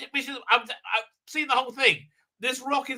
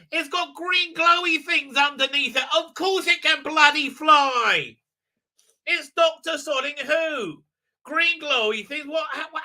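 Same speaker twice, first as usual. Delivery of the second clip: high, emphatic comic outrage; exasperated rant; 6 semitones higher, 4 dB louder than usual. Real, not acted. Reviewer arguing the boat can obviously fly, high exasperated rant. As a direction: rant it high, fed up with the stupidity. Ranting at the plot.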